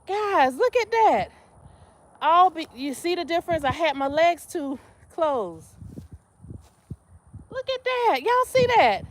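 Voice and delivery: high, loud proud look at that; excited showing off. Real, not acted. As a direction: show off the squat. Shows off deeper squat.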